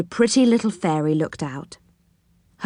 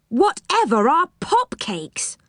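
slow, high-pitched puzzlement; baffled, stretched-out high question; surprised question; 8 semitones higher than usual. A narrator's reading. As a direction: ask in drawn-out surprise, voice raised. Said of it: "asked Rick in surprise".

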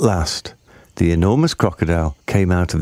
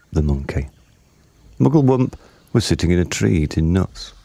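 ordinary delivery

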